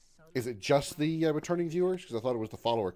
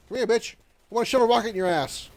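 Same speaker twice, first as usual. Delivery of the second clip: taunting; 10 semitones higher, 5 dB louder than usual. Pretend. Taunting enemy in game.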